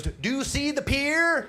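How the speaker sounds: high, slow yelled question across water; shouting from water skis; mock yell from afar. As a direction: call out over a boat engine, drawn out. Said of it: "what she said was".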